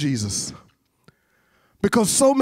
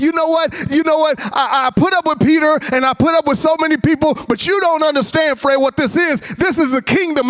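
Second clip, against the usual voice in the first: higher-pitched, exasperated mock rant; fed-up Jesus; 7 semitones higher than usual. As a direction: act out Jesus running out of patience. Acts out Jesus losing patience.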